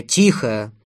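short, loud command; brief narrated yell; shouting. A narrator's reading. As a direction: cut in with a sharp order for silence. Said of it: "закричала Мария".

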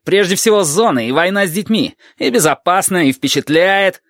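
high-pitched indignant sarcastic tirade; flaring up. A narrator's reading. Valentin rails at the military's powers, flaring up. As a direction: tear into the authorities with rising sarcasm. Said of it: "взвился Валентин".

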